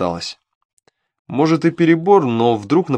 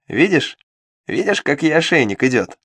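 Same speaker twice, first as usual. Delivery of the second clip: gloating imp; 4 semitones higher than usual. Acted. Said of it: "восторжествовал бес".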